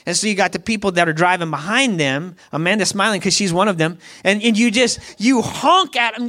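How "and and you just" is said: usual speaking voice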